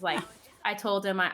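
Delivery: ordinary delivery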